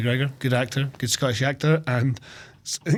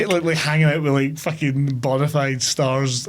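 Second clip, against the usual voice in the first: normal talking voice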